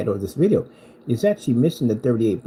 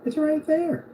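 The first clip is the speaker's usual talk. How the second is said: high whiny voice for the rival; mocking impression; 11 semitones higher, 4 dB quieter than usual. Mocking the rival with a high whiny voice. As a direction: whine it up high like a crank. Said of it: "He's always like".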